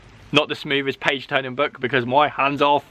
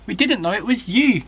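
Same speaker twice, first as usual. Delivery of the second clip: breathless relief; 9 semitones higher than usual. A narrator's reading. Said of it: "gasped Alfie".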